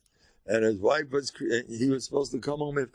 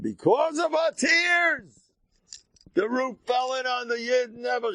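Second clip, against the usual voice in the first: dramatic shout; 9 semitones higher, 3 dB louder than usual. A narrator's reading. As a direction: cry it out at the climax. Climax of Gemara story.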